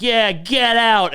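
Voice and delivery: loud, high, drawn-out jeer; imitated crowd yell, slow and high. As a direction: shout it like a whole audience. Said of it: "everyone's like".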